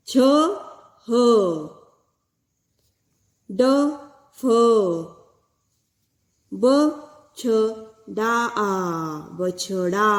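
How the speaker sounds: each letter called out loud and clear; loud syllable spelling. Shown as a demonstration. Teacher reading बछड़ा aloud to the class, loud and clear. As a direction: sound out बछड़ा letter by letter, loudly. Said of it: "चलो हम करते हैं चित्रों का वाचन".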